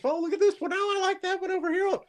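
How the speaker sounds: high, gushing, sing-song delight; mock excited family member. Mocking his family picking trees with high, gushing delight. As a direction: gush over every tree like an eager relative. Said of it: "go out looking for a Christmas tree with the family".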